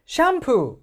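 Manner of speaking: 샴푸 with a Korean flat first-syllable push; mock wrong 샴푸; wrong stress. Shown as a demonstration. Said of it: "못 알아듣는다는 거예요".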